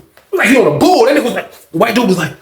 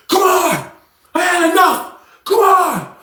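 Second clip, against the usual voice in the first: much higher fired-up call; taunting challenge; 10 semitones higher, slower than usual. Retold or imitated. John squares off with the predator, taunting. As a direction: egg him on, ready to fight. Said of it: "John turned into a crazy psycho".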